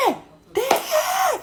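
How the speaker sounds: very high, shrill-pitched mock shout; yelling at the taxi driver to cut him off; retold yell. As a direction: cry out to stop him. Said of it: "je commence à hurler aussi".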